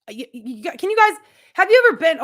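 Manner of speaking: a rattled, pleading burst of stammers; flustered stammering plea. Retold or imitated. Retelling her stammered plea to church friends, flustered and loud. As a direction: stutter it out, jumping high and loud.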